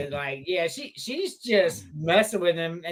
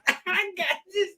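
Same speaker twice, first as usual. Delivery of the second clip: high, drawn-out, nearly crying with laughter; helpless laughter; 7 semitones higher, slower than usual. Real, not acted. Podcaster recalls watching the clip, cracking up. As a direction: squeeze the words out through rising giggles.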